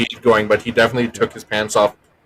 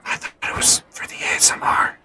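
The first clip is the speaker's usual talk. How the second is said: ASMR style whisper; 4 dB quieter than usual. Real, not acted. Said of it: "Why are we whispering?".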